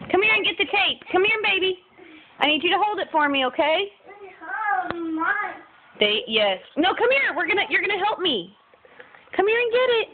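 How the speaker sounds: high, sing-song coaxing; coaxing mom voice. Real, not acted. Coaxing toddler to come.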